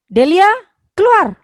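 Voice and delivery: sharp, loud order, pitch jumping up on keluar; angry command. Shown as a demonstration. Teacher demoing an angry order to Delia, loud and high. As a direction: snap at Delia to get out. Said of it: "akan muncul berbagai makna".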